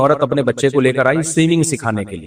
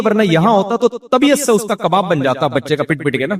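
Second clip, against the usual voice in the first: ordinary talk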